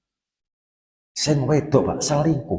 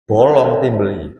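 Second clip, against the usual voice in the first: ordinary talk, nothing marked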